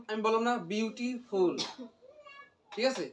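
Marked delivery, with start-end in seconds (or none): 0.6-1.6 beautiful drawn out slowly in two parts, beauty and ফুল; split into syllables. Shown as a demonstration. Teacher reminding students it has two syllables, split slowly. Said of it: "এখানে দুই syllable না?".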